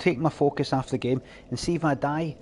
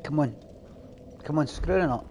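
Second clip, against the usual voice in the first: ordinary talk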